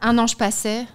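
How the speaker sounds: reading a love letter with flair; dramatic letter reading. Acted. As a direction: perform the letter like a declaration. Reads a love letter aloud.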